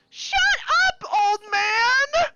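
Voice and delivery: drawn-out, high shouted heckle; heckler yell. Acted. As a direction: bellow it from the crowd. Heckler yells at the mayor.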